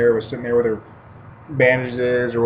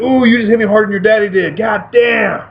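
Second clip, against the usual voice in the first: high, louder hammed-up wince after the hit; mock pained high voice; 8 semitones higher, 5 dB louder than usual. Fighter hamming up pain to the kid who hit him. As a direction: play it up like it stung. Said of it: "And I was like".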